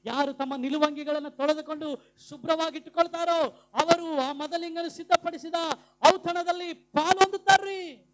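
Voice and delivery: loud, rising pulpit delivery; fired-up proclamation; full-force preaching. Real, not acted.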